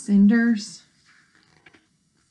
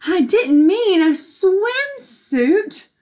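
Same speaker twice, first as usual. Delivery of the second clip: slow, higher fairy voice; fairy's dismay; 7 semitones higher, 5 dB louder, much slower than usual. A narrator's reading. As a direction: drag it out, dismayed. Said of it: "thought the fairy".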